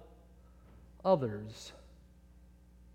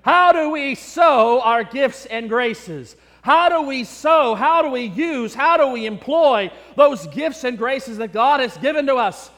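preaching; 3 semitones higher, 5 dB louder than usual. Acted. Pastor challenging his congregation, in full preaching voice. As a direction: hammer each question at the congregation. Preacher drives home the lesson.

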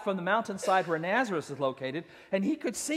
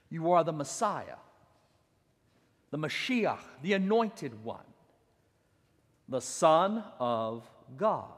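reverent; 5 semitones lower, 4 dB quieter than usual. Acted. Slow, reverent quote.

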